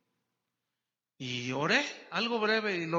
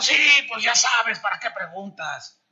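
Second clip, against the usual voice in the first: loud, rude hungover snap; grumpy husband; 7 dB louder than usual. Retold or imitated. Grumpy hungover husband answers his wife, loud and rude. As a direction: bite back like a grumpy drunk. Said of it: "De esos enojones así".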